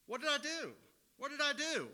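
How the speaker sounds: put-on high whiny teenage "what did I do"; eye-rolling teen. A child caught out whines at the parent, high and mock-innocent. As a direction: play the kid feigning innocence. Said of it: "rolling their eyes going".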